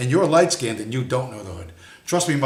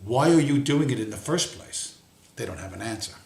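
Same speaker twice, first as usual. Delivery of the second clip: usual speaking voice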